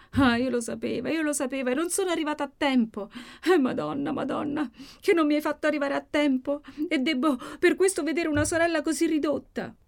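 high, wailing lament; anguished lament. Acted. Maddalena laments over her wounded sister, wailing. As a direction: cry out to the Madonna in grief.

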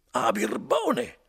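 put-on drunken indignant cry; furious exclamation. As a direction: bellow the insult. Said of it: "esclamò Renzo".

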